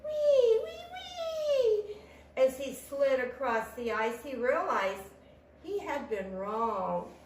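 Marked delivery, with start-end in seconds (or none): 0.0-2.0 high, long "whee"; gleeful squeal. A narrator's reading. Tortoise flying off his sledge, gleeful squealing. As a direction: squeal it high, holding the last one.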